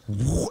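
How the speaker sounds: drawn-out "whoo" of a car roaring in; imitated car whoosh. Describing her car coming down the lawn, imitated engine whoosh. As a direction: make the noise of a speeding car.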